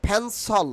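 "ফ্যানসল" as phen-sol, puffed p; corrected pronunciation. Shown as a demonstration. Teacher drilling learners, "ফ্যানসল" with puffed p and sol ending. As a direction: aspirate the start of "ফ্যানসল", end on sol. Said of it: "আমি আবার repeat করছি".

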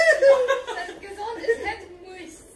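slow, loud, theatrical line reading; milk every word like a stage actor; dramatic reading. Acted. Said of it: "[name]'s Nat five drama skills coming out there".

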